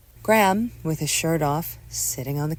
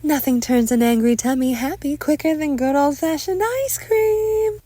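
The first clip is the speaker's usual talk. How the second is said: high, loud forced cheer; forced smile; 12 semitones higher, 7 dB louder than usual. A narrator's reading. Mother breaks tension with ice cream, loud forced cheer. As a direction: beam it out through a forced smile. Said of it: "Patricia forces a new smile".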